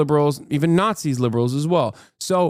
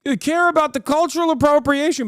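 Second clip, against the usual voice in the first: high, mocking whine of a right-wing scold; high squeaky mock outrage; mocking whiny activist; 11 semitones higher than usual. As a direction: sneer it in a squeaky parody voice. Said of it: "but, like, in a right-wing fashion".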